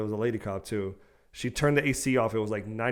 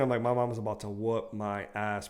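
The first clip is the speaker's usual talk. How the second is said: ordinary talk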